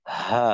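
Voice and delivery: low-pitched "ha" showing the h; ha articulation. Shown as a demonstration. Teacher contrasts ha with hamza for students, ha articulation. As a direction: sound ha alone, set against hamza. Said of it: "হায়ের উচ্চারণটা".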